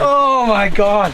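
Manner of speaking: loud, slow, drawn-out exclamation; elated awe. Real, not acted. Angler marvels over the giant walleye, elated awe. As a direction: stretch it out in real amazement.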